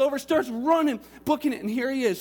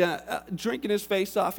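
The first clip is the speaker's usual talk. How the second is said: normal talking voice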